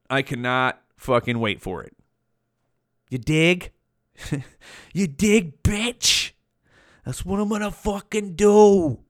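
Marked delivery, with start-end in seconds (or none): 3.1-9.0 higher, swaggering mock-gangster voice; put-on swagger voice. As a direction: strut it out with a cocky put-on voice. Cocky bragging put-on voice.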